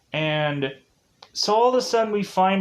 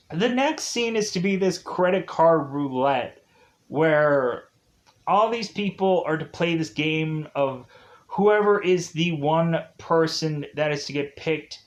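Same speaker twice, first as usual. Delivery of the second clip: usual speaking voice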